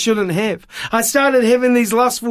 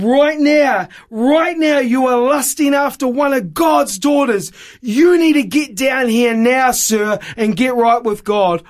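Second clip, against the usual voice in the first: retold preacher calling him out, raised and higher; preacher's rebuke; 5 semitones higher than usual. Evangelist points at man in balcony, raised rebuke. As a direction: play the evangelist nailing him from the stage. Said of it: "and he said".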